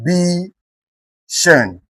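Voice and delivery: "B, shun" as separate syllables, falling on "shun"; split into syllables. Shown as a demonstration. Teacher putting word into syllables, syllable by syllable. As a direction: sound out "B", pause, then drop on "shun". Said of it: "put this word into syllable".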